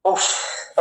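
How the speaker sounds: demonstrated voiceless "uff" exhale; breath out. Shown as a demonstration. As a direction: show the class a blown-out breath. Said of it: "మనం breath out". (ఉఫ్ అని గాలి వదలడం)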